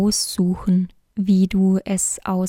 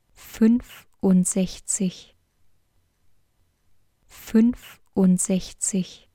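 whispered counting. Acted. Teacher to learner practising numbers, whispered counting. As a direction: whisper each number slowly and repeat it.

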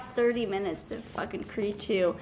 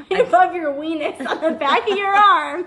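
laughing; 5 semitones higher, 5 dB louder than usual. Real, not acted. Laughing repeat of friend.

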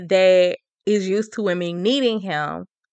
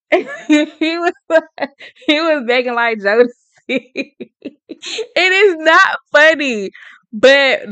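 talking through real giggles, pitched up; real laughing; 5 semitones higher, 4 dB louder than usual. Real, not acted. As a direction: crack up while trying to get the words out. Cracks up over the begging.

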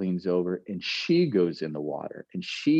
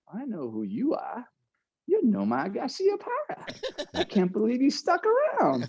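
higher, giggly imitation of a tipsy girl; drunk girl. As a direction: play the drunk girl starstruck, laughing. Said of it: "she just goes".

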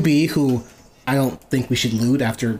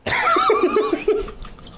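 high-pitched gasp through laughter; laughing gasp. Real, not acted. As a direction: gasp for air while laughing.

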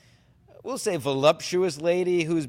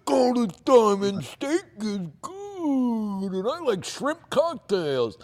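dumb uncle voice; 9 semitones higher, slower than usual. Retold or imitated. Mocking uncle's dumb boast.

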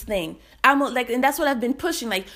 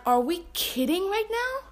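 incredulous; 8 semitones higher, 4 dB quieter than usual. Retold or imitated. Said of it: "And I was like".

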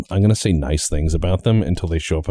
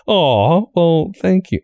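flattered leader; 10 semitones higher, much slower than usual. Pretend. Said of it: "And they're like".

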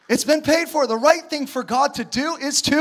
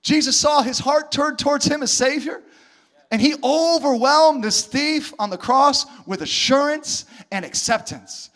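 ordinary talk

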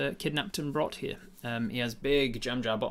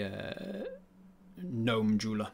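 ordinary delivery

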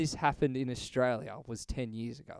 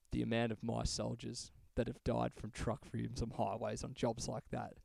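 mock solemn; 4 semitones lower, 10 dB quieter than usual. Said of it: "he put on this serious face".

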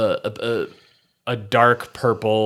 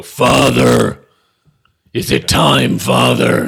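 dopey cartoon bellow; 3 semitones higher, 11 dB louder, much slower than usual. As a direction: bellow down the well like a giant child. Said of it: "a booming voice above you go".

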